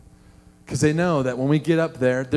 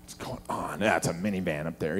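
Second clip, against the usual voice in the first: low, quiet mock grumbling; hushed, deep-pitched disgruntled aside; disgusted grumble; 11 semitones lower, 10 dB quieter than usual. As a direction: mutter like an irritated driver waiting in line. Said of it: "they're just disgusted. They're like".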